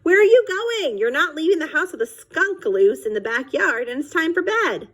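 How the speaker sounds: high, urgent mom voice; a mother calling her daughter back, high and insistent; mother stopping her. Acted. Mother stopping Amelia Bedelia from going out, high and urgent. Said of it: "her mother stopped her".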